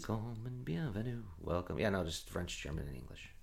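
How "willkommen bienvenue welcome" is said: quiet run-through. Shown as a demonstration. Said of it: "Is that the only three?".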